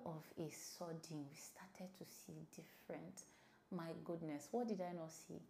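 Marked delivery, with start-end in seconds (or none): 0.0-3.2 hushed dramatic whisper. Real, not acted. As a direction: drop to a whisper for effect. Dramatic aside about the reaction.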